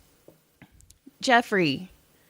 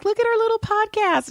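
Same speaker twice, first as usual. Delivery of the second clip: proud squeal; 13 semitones higher, faster than usual. Retold or imitated. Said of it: "I was like".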